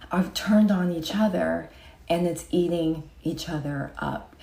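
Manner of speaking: hushed whisper. Real, not acted. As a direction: drop to a whisper. Whispered aside.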